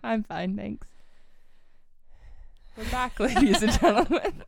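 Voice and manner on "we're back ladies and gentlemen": giggly, loud show opener; laughing announcement. Real, not acted. Host opening the podcast to listeners, laughing loudly. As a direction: announce the comeback through a laugh.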